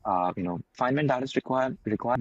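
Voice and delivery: normal talking voice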